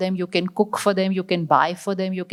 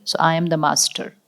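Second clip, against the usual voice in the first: normal talking voice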